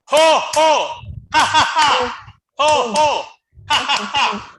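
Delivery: high loud rhythmic ho ho ha ha; booming rhythmic laugh. Shown as a demonstration. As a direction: chant the laughter in beats. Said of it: "I'm gonna show you".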